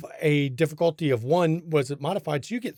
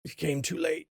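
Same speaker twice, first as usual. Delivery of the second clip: gurgling voice; 5 dB quieter than usual. Acted. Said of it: "and in a gurgling voice".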